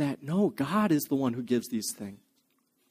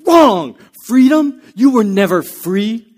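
a high, very loud yell, then slower on never free; furious shout; 6 semitones higher, 12 dB louder, slower than usual. Retold or imitated. Hopkins rejecting freedom as an answer, shouting furiously. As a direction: roar it as he rips the page.

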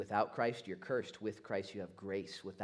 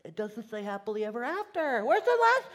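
rising to high, loud shrieks; mock freak-out; 13 dB louder than usual. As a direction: panic theatrically, escalating into screaming questions. Acting a reader freaking out.

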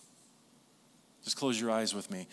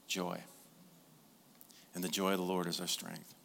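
normal talking voice

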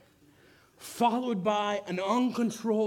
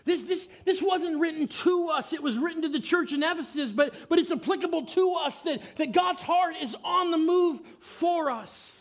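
fervent preaching; 6 semitones higher than usual. Real, not acted. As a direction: preach it with building conviction. Preaching at full force.

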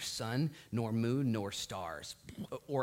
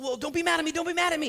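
high, loud, panicky pleading; mock frightened plea; 8 dB louder, much faster than usual. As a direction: squeal it like a scared little man begging. Paul voiced as frightened.

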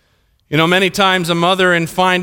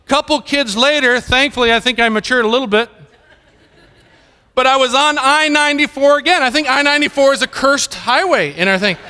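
ordinary talk

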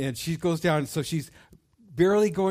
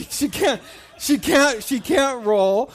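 laughing; 10 semitones higher, 9 dB louder, slower than usual. Real, not acted. Laughing at failed roll.

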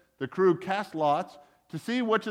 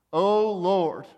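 louder, drawn-out appeal; narrated plea; 3 semitones higher, 5 dB louder than usual. A narrator's reading. Sailors crying out to Jonah's God, pleading. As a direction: cry out in mild supplication. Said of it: "they pleaded".

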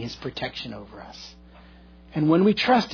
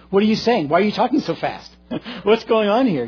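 imitated confrontation; 5 semitones higher than usual. Voicing the aggressive stranger's questions.

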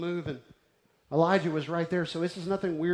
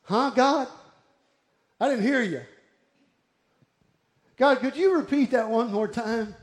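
loud, higher-pitched asking God to repeat; hard-of-hearing call; 6 semitones higher, 6 dB louder than usual. Acted. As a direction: call up as if you missed it. Acts out not hearing God.

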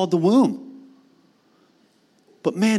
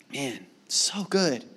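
quiet awe; 5 semitones lower, 6 dB quieter, much slower than usual. Real, not acted. Quiet praise.

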